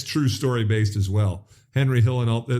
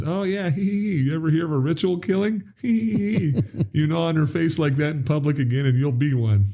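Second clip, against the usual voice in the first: higher, laughing through a mock threat; menacing uncle; 6 semitones higher than usual. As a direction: play the tough uncle while cracking up. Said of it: "Candy goes".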